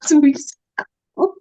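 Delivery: high, loud laughing plea. Real, not acted. Laughing while recalling a chat with her sister. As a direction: laugh and wave the memory away.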